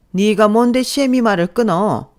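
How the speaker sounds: how dare she cut you off; angry mother-in-law. Acted. Mother-in-law yelling at her daughter-in-law for interrupting, indignant.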